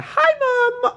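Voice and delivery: squeaky hi to mum; high cheery greeting. Real, not acted. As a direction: chirp it brightly.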